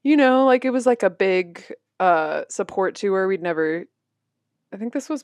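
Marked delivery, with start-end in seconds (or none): none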